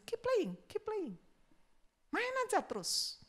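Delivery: high, soft coaxing; gentle encouragement. Retold or imitated. Pianist encouraging the child at the piano, soft and high. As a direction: coax the child softly to keep going. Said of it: "dia bilang sama anak kecil".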